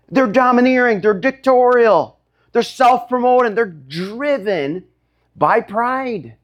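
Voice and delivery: high, emphatic list of charges; impassioned preaching. Real, not acted. Preacher denouncing proud Gentile rulers to the church, impassioned. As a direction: denounce them high, stretching the final charge.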